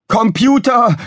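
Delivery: high, loud summons; Gizmo calling out. A narrator's reading. Engineer summons ship's computer, urgent shout. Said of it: "rief Gizmo".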